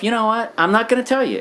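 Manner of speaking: high, spiteful inner voice; defiant. Retold or imitated. Husband telling himself off at his wife, high and spiteful. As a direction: dig in your heels, petty and defiant. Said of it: "I would say to myself".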